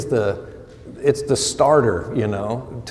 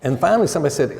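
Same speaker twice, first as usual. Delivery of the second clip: ordinary talk, nothing marked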